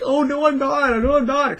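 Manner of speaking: high, humble brushing-off voice; high polite self-deprecation; modest denial. Retold or imitated. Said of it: "you have to be like".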